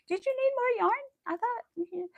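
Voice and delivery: high, mock-questioning voice imitating him; teasing husband. Imitating her husband teasing her about yarn purchases. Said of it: "always his question is".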